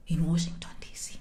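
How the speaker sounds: low whisper trailing almost to nothing; secretive whisper. Pretend. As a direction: drop the place name to a hush. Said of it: "Let's whisper".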